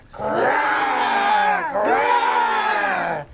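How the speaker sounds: loud, high, drawn-out bear growl. Pretend. Teacher asks pupils to growl like a bear, loud growl. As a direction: growl big for the exclamation point. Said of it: "can you growl like a bear".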